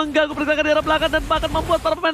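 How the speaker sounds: high, loud hype play-by-play; esports caster. Acted. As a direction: call the teamfight at full excitement. Hyped play-by-play. (komentator esports heboh)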